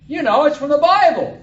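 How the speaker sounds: very loud, high-pitched shout; acted bystander's holler; hollered answer. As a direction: bellow it across the room.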